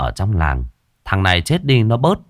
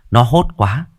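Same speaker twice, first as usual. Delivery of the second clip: ordinary delivery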